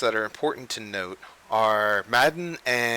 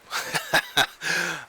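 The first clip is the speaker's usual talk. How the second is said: real laugh, higher than the speaking voice; embarrassed laugh; 6 semitones higher than usual. Real, not acted. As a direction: laugh at your own slip-up. Laughs at own geography slip.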